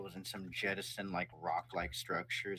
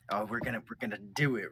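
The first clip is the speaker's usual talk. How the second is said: hyped; 2 semitones higher, 4 dB louder than usual. Acted. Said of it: "hyped for the".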